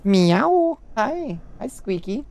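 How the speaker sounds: high hushed pet talk. Real, not acted. Greeting cat Squeaky tenderly.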